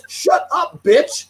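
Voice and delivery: reliving shouting her down; retold yell. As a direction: fire it back at her hard. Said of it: "I go".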